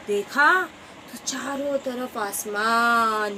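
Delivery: usual speaking voice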